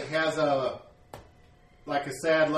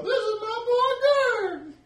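very high, slow joyful cry; overjoyed old mother; 8 dB louder, slower than usual. Acted. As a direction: greet her long-lost boy. Mother introduces her son.

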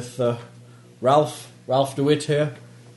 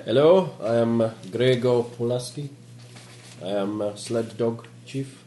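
put-on Polish accent; 3 semitones lower than usual. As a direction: introduce yourself haltingly with a Polish accent. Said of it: "You are Polish".

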